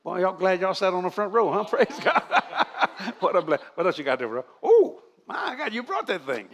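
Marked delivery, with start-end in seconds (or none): 4.6-6.5 high, drawn-out exclamation; surprised. Real, not acted. Preacher surprised as an assistant hands him a book.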